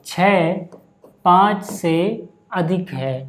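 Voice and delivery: slow, measured model reading; reading demonstration. Shown as a demonstration. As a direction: space the words out for learners. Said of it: "याद रहे इनको पढ़ने का तरीका".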